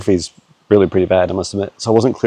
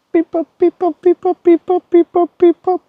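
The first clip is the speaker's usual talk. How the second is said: very high robot-like beep boop; high beeping chant. Pretend. Trying the beeping call again.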